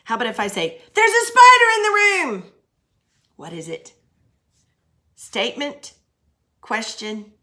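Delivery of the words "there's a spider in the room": high, loud frightened cry; panicked spider alarm, much higher and louder; alarmed exclamation. Shown as a demonstration. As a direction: shout it like the spider just appeared. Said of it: "It's an exclamation".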